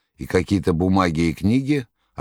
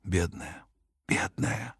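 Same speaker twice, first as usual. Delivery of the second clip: soft breathy murmur, almost voiceless; muttering; 10 dB quieter, slower than usual. Acted. The master comforting Margarita, soft mutter. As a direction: mutter it into her hair, tenderly. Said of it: "стал бормотать".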